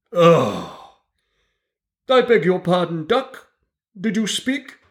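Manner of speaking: high, slow, self-important mouse; pompous mouse lecturer. A narrator's reading. Mouse lectures the dripping animals, high and pompous. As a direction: lecture the wet animals grandly, like a tiny professor.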